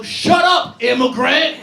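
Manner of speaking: sudden loud high jokey shout; mock yell. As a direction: bark it out as a fake joke. Mock joke yelled at coworker.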